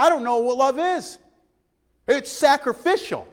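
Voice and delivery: loud, rising preacher cadence; preaching at full force. Acted. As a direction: preach it out to the church, pushing hard. Repeated "while we were" build.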